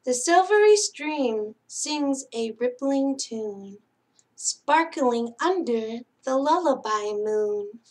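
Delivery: singsong picture-book reading; bedtime story reading. Acted. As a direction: read it like a lullaby to sleepy kids. Reads bedtime picture book aloud.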